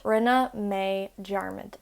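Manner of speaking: Rinne mé dearmid drawn out slowly; slow it down so learners catch it; slow teaching repeat. Shown as a demonstration. Teacher repeats Rinne mé dearmid slowly for learners.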